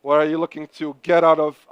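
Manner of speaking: ordinary talk, nothing marked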